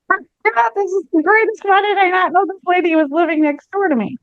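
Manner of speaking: high, delighted exclamation; gleeful. Acted. Old man gloats about his new neighbor, high gleeful voice. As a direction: crow with delight at his luck.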